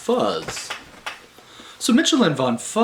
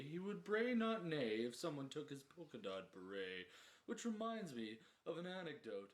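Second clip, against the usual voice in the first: a dull bore droning on, fading ever softer; 3 semitones higher, 10 dB quieter than usual. Acted. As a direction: drone on like a bore who won't let the guests go. Droning boring Henderson tale, ever quieter.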